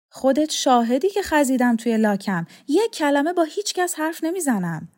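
a hint of a hurt old man insisting; defensive old man. A narrator's reading. Old man assures Laqa he keeps the secret, mock protest.

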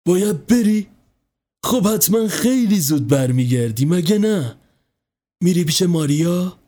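hope hard he'll be back soon; anxious mother. A narrator's reading.

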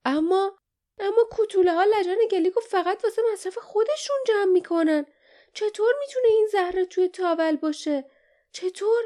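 high, fast, loud cry; urgent high-pitched yelling; Jasmine. A narrator's reading. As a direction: shout in bewildered protest. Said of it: "جاسمین فریاد زد".

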